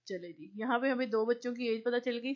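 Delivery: ordinary talk, nothing marked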